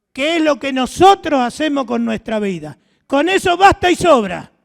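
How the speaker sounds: loud, rousing pulpit voice; fiery preaching. Acted. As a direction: preach it out to the congregation. Preaching at full force.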